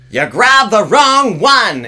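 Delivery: put-on high, slow, loud shrill-free squawk of an old woman; old lady yelling. Old lady yelling at another in church about her walker. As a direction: belt it like an upset old lady.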